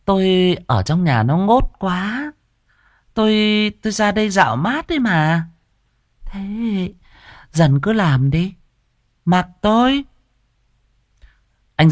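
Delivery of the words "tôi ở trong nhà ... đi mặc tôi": lightly voiced mistress, feigning ease; a sly landowner's wife brushing off her servant; lady's voice, coy. Mistress to servant Dần, coy and high.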